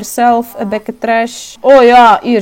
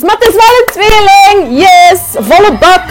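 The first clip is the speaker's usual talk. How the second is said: higher, loud delighted exclamations; excited cheer; 8 semitones higher, 5 dB louder than usual. Real, not acted. Gamer cheering at surprise twins, excited.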